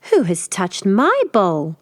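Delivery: a milder, slow mother bear growl. Acted. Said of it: "Mother Bear growled".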